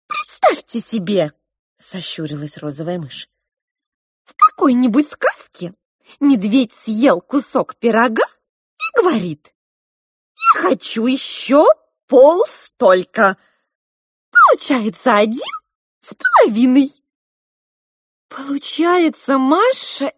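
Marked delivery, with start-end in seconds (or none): 0.1-1.3 higher, slowed mouse voice; pink mouse. A narrator's reading. Розовая мышь объясняет. 4.2-16.9 high, loud piping mouse storyteller; pink mouse. A narrator's reading. Розовая мышь рассказывает пример.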